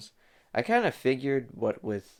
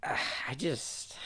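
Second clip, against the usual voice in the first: sighing whisper; 4 semitones higher, 7 dB quieter than usual. Real, not acted. Sighing over same-sounding music.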